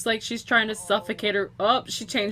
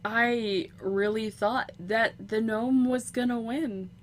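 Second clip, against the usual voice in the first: ordinary delivery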